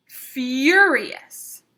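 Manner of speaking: furious slowed and punched loud; stress on furious. A narrator's reading. Reader stressing furious to the class. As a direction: hit the word furious.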